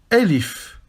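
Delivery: Elif spoken clearly as a model; Arabic letter name. Shown as a demonstration. Teacher modelling Elif for students to repeat. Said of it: "Répétez après moi".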